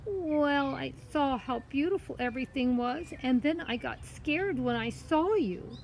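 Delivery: put-on high girl's voice explaining herself; innocent story girl. Girl explains her fright to the cat, innocent. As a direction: pitch it high, earnest and innocent.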